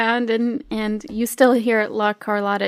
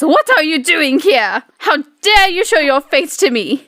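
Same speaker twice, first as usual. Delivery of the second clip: angry diva; 4 semitones higher, 4 dB louder than usual. Acted. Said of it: "she looks angry, and she says".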